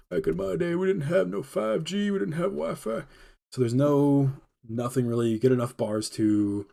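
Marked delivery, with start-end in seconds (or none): 0.1-3.0 put-on old guy voice. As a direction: play a cranky old-timer. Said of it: "If I sound like an old guy".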